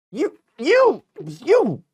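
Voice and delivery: high, punched mocking repetition; incredulous mockery. Commentator ridiculing the accused's apology, incredulous mockery. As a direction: hammer each word in a high mocking voice.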